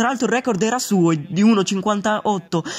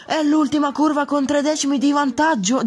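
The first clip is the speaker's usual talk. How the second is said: ordinary talk, nothing marked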